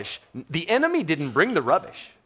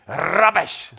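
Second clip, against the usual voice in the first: rubbish in a British accent, raised and loud; 4 semitones higher, 5 dB louder than usual. Shown as a demonstration. Preacher showing off a British accent on one word. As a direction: say Rubbish the British way, higher and punchy. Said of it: "If you say it with the right accent".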